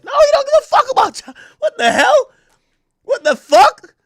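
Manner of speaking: high, fast, loud disbelief; incredulous outburst. Real, not acted. Ranting to the co-host about [name]'s critics, high incredulous outburst. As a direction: squeal it in total disbelief.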